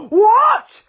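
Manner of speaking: loud high startled yell; giant's yell at the falling things; tremendous yell. A narrator's reading. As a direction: bellow up into the tree. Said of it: "He gave a tremendous yell".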